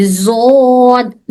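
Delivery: زود held very long with a z sound; drawn-out zod. Shown as a demonstration. Teacher contrasting zod against dhad for students, very slow. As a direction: stretch زود to show where the tongue does not touch. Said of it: "زو میں زبان ویسے نہیں touch ہو رہی".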